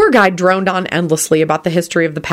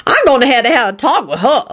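high, emphatic resolve; determined; 7 semitones higher than usual. A narrator's reading. Lucretia resolving to advise Elizabeth, determined. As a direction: declare it with determination. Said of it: "Lucretia nodded determinedly".

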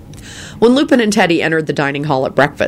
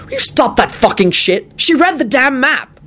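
exasperated Quentin; 10 semitones higher than usual. A narrator's reading. Quentin snaps at Lupin.

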